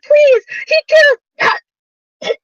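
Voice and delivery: put-on high, loud sobbing cry of a child; crying plea. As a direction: beg through tears, terrified. Dying child pleads, crying.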